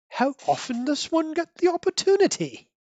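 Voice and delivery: high-pitched, theatrical relish; relishing thespian Alfred. Acted. Proud thespian butler enjoys his moment of acting, relishing it theatrically. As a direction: savor it like a proud stage actor. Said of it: "imagine him being relishing it".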